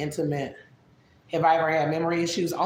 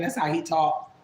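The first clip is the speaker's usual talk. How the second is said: ordinary talk, nothing marked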